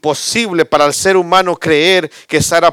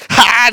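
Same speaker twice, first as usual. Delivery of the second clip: mock laugh; 10 semitones higher, 7 dB louder than usual. Mimics Sara's laugh.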